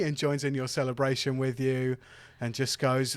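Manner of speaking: normal talking voice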